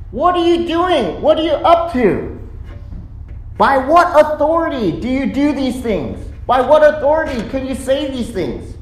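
high, indignant interrogation; mad. Acted. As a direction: demand answers like an outraged elder. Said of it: "They're mad".

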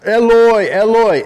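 acted cry from the cross; calling out. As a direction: call out high and loud. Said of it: "he's calling out to the mighty God".

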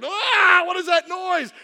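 high, loud outburst; acted motherly exasperation; mom's exasperated cry. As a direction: cry out as if the noise is unbearable. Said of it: "My mom's like".

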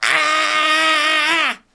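high, loud scream of a man on fire; a sudden, piercing shout of agony played for laughs; burning man scream. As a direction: scream like you're burning alive. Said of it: "my impression of Philip Seymour Hoffman".

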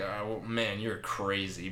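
dropped low and soft, imitating Odin; low offhand aside. Odin humouring the storyteller at Valhalla's gates, low and soft. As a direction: mutter it low as an unimpressed god. Acting Odin's aside to narrator.

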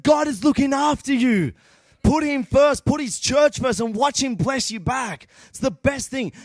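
high, driving, fervent preacher voice; full-force preaching. Real, not acted. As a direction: preach it hard with rising urgency.

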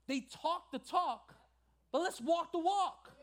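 high, emphatic pulpit delivery; impassioned preaching. Acted. Preacher telling church to make room for God, fervent preaching. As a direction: preach it hard, punching the key phrases.

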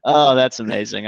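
ordinary delivery

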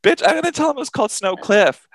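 high fast indignant shriek; shrieking indignation. Retold or imitated. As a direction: squeal back in mock outrage. Outraged reply to friend.

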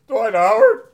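very high, slow laughing question; can't believe it; shrieking disbelief through laughter; incredulous disbelief. Real, not acted.

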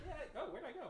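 usual speaking voice

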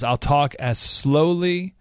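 drawn-out words with long pauses between; deliberately very slow. Shown as a demonstration. Host showing how he talks to reporters, very slowly. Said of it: "like, literally this".

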